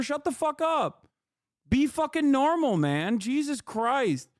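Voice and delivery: exasperated outburst at chat. Real, not acted. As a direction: drag it out in disbelief. Frustrated rant at chat.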